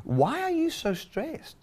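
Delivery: high, slow, gentle questioning as Jesus; sweet mock-tender question up high; Jesus voiced. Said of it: "And then Jesus said".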